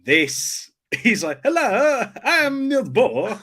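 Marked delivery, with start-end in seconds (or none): 1.4-3.4 high, mock bad South African accent; hammed-up South African accent, pitched way up; Kenneth Branagh. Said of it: "He cannot do accents".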